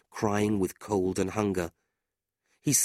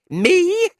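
very high, loud squeal of indignation; outraged cry; 10 dB louder than usual. A narrator's reading. Fanny stung by Tilda's insult, outraged. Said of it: "Fanny cried".

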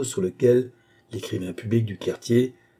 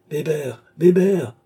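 high call, much louder on the repeat; 6 semitones higher, 5 dB louder, slower than usual. A narrator's reading. Lady to her servant in the crowd, calling out louder. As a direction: call the servant's name, rising to a shout.

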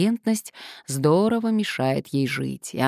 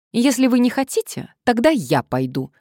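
pushy plump woman; 8 semitones higher, 5 dB louder than usual. A narrator's reading. Blonde threatens to go.

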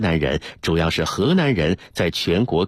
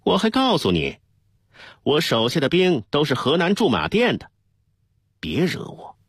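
defiant retort; 8 semitones higher than usual. A narrator's reading. Deputy counters the new captain, defiant retort. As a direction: fire back with mild defiance. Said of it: "张全玉反击道".

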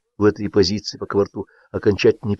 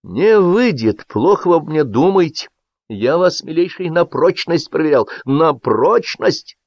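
heated reproach; 7 semitones higher than usual. A narrator's reading. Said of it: "продолжал он с обострением".

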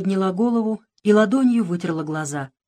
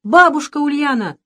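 breathless call; 8 semitones higher, 7 dB louder than usual. A narrator's reading. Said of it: "повторил он, задыхаясь".

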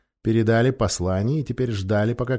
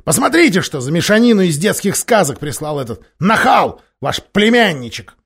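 loud, higher angry tirade; angry king; 7 semitones higher, 7 dB louder than usual. A narrator's reading. Miraz scorning the challenge to his lords, loud angry voice. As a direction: rage at the impudent nephew.

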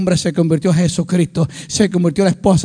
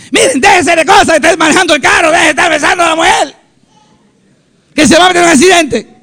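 high, loud mock tirade; imitated scolding mother-in-law; 7 semitones higher, 5 dB louder than usual. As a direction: act out a mother-in-law telling off the driver. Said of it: "la suegra mía me insultó".

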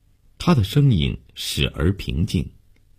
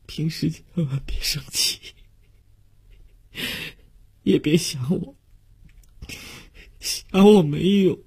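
sobbing farewell, high and broken; words broken up by heavy sobs, long gaps; 强烈抽泣; 13 semitones higher than usual. A narrator's reading. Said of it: "也被强烈的抽泣打乱，". (抽泣得断断续续，词语不清)

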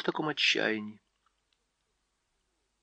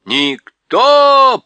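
much higher, much louder owl shriek; owl yelling; 12 semitones higher, 13 dB louder than usual. A narrator's reading. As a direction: shriek it at the deaf dwarf. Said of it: "прокричала сова".

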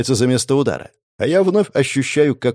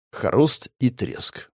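plain reading voice

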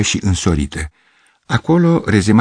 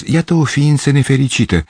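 self-pitying musing; 6 semitones higher than usual. A narrator's reading. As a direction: sigh over the poor girl. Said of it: "mi-am spus".

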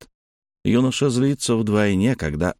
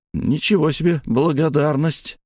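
ordinary delivery

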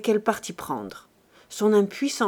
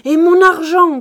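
much louder, higher yelled demand; furious shout; 8 semitones higher, 13 dB louder than usual. Acted. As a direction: scream for your money. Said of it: "criait-il".

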